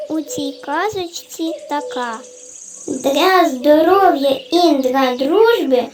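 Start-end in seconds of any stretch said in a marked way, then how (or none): 2.9-5.8 louder, emphatic announcement of the moral; proclaiming the moral. A narrator's reading. Narrator to the children, announcing the moral loudly. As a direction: ring out the lesson to the little ones.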